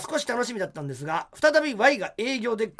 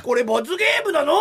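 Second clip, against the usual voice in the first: put-on high, loud whiny kid; son's complaint; 7 semitones higher, 7 dB louder than usual. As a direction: whine it like a child. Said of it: "息子も".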